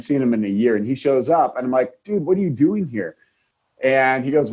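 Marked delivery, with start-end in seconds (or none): 2.0-3.2 surprised. Retold or imitated. Surprised by former patient's visit.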